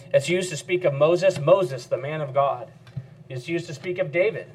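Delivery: ordinary delivery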